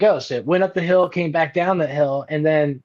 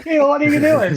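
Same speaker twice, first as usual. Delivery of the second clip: laughing disbelief; 11 semitones higher, 4 dB louder, faster than usual. Retold or imitated. Laughing at absurd advice.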